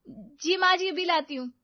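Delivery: ordinary delivery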